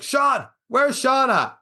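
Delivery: drawn-out high call across the crowd. Retold or imitated. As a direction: call his name out slowly, searching. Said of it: "And they're like".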